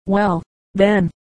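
understated snarling wolf; a touch of menace in a storybook wolf's voice; mild snarl. A narrator's reading. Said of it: "snarled the wolf".

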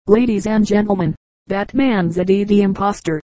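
ordinary delivery, nothing marked

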